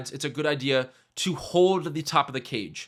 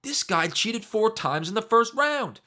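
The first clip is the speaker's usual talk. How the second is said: high, drawn-out mock outrage; losing his mind; 8 semitones higher, slower than usual. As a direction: imitate an outraged coach protesting at a high pitch. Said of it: "This dude's losing his mind".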